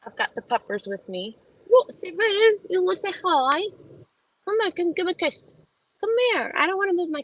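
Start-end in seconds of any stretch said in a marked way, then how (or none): 1.7-7.2 high, squeaky pet voice. Real, not acted. Baby talk to the dog.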